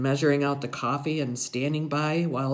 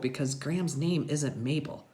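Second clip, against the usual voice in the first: ordinary delivery, nothing marked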